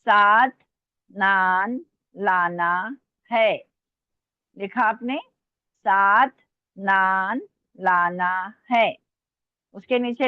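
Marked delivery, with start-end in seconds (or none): 0.1-3.5 very slow, word by word; slow dictation. Real, not acted. Teacher reading back the sentence to students, slow dictation. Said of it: "मैं आराम आराम से बोल रही हूँ". 5.9-9.0 stretched words with small gaps; slow dictation. Real, not acted. Teacher repeating it after checking students wrote, slow dictation. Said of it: "मैं आराम आराम से बोल रही हूँ".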